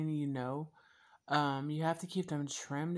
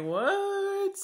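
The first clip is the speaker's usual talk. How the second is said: high, squeaky "what?" in retold surprise; imitating her own stunned reaction; mock baffled what; 5 dB louder than usual. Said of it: "I'm just like".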